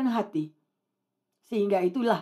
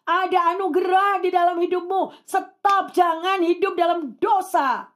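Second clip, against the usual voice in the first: high, emphatic preacher's cry; fervent exhortation; 6 semitones higher than usual. Real, not acted. Preacher commands the congregation to stop sinning, fervent exhortation. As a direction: urge them with pulpit fervour.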